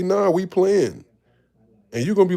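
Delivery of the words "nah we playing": put-on drawn-out, loud, higher tough-kid taunt; mock bully claiming the ball; slow menacing bully. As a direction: lean on it slow like you own the court. Bigger kids taking his ball.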